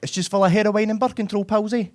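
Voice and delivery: high-pitched mock Dundee accent; Dundee shop lady. Bakery lady listing cake ingredients, high Dundee accent. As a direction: chirp it in broad Dundee accent. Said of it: "the lady behind the counter".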